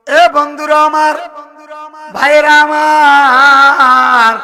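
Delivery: loud, long drawn-out address; ringing, very slow call to the congregation; preacher's rallying call. Real, not acted. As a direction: hail the crowd, stretching the last word. Preacher hails his audience.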